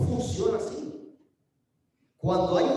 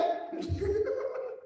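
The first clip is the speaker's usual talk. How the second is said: breathy chuckle; airy rising laugh; 11 semitones higher, 7 dB quieter than usual. Real, not acted. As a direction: laugh at your own joke. Laugh after the punchline. (risa soplada)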